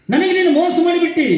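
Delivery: high, indignant holy man's outburst; angry accusation. Acted. Angry jangama accusing Siriyala of cheating him, indignant. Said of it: "ಜಂಗಮಮೂರ್ತಿ ಕಣ್ಣು ಕೆಂಪು ಮಾಡ್ದ".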